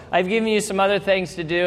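normal talking voice

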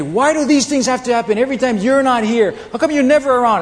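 high, loud frustrated wife; loud high-pitched "never around" complaint; exasperated complaint. Retold or imitated. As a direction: let the frustration burst out.

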